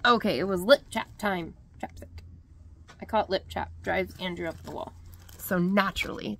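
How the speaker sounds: ordinary talk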